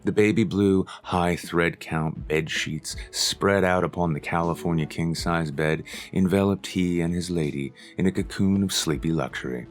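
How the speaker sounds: ordinary delivery, nothing marked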